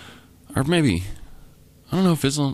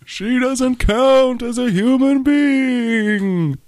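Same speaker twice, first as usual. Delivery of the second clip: very high, slow ghostly voice; 14 semitones higher, 4 dB louder, much slower than usual. Acted. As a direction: drawl it out like a spooky ghost. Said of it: "It's the ghost talking".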